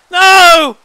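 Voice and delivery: shouting high and loud in mock despair; dismayed shout. Real, not acted. Streamer shouting at the rain on the wedding day, loud dismayed wail.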